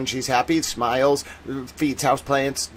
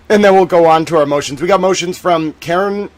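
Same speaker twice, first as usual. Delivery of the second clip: normal talking voice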